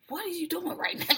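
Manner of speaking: a hushed high take on a confused glance; soft, high incredulous imitation; Maggie's baffled face. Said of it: "Maggie's face is just like".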